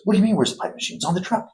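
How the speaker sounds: hammed-up indignant cousin; incredulous boss. Cousin scoffing at the plumber about the missing machine, high mock disbelief. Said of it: "He goes".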